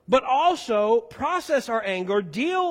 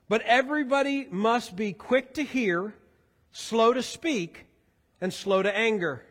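scripture reading; 4 semitones lower than usual. Real, not acted. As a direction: read the verses out to a congregation. Reads scripture aloud to congregation.

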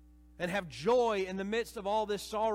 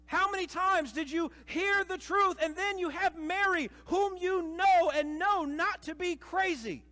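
ordinary delivery

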